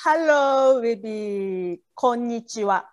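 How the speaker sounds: ordinary talk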